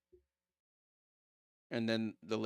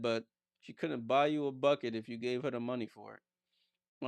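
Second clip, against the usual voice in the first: usual speaking voice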